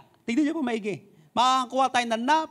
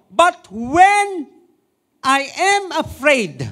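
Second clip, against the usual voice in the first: slow, raised preaching voice on the verse; loud proclamation; 7 semitones higher, 8 dB louder, much slower than usual. Acted. (malakas na pagpapahayag)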